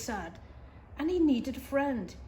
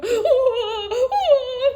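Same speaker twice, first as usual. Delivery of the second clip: very loud, much higher bawling; echoed mock wail; 13 semitones higher, 13 dB louder than usual. Voice across the pond echoes the beaver, high loud wailing. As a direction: wail it back even harder. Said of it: "said the voice from across the pond".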